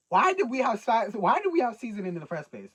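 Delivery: loud, disbelieving outburst; incredulous shout. Real, not acted. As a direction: yell it in disbelief. Incredulous rant at confessor.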